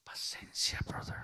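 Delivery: breathy half-whispered "Paciencia, brother"; hushed aside. Retold or imitated. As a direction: murmur it under your breath as a private retort. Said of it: "Y me dan ganas de".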